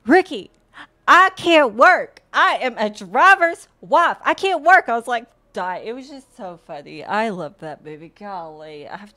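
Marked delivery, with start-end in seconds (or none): 0.0-4.9 loud, higher-pitched mock outburst as the wife; imitating film wife. Quoting Ricky's wife from the film, mock indignant shouting. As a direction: play the indignant wife, punching each phrase loudly. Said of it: "when his wife is like".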